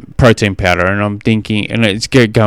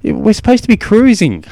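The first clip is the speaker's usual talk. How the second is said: exasperated dad; 10 semitones higher than usual. Retold or imitated. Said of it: "and I'm thinking".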